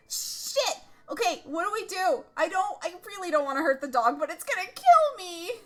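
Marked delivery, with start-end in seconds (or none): none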